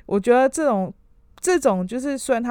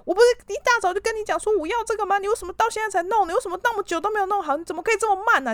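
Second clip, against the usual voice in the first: much higher, fast berating mock tirade; imitated angry scolding; 11 semitones higher, faster than usual. Boss berating employee over late report, high fast mock anger. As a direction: pile the questions on like an exasperated boss. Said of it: "很生气，就骂他说".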